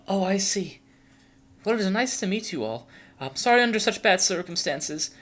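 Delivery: put-on high, polite young vampire voice; Marionette, courteous and apologetic. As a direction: greet them formally, pitched well up. Game master voices Marionette.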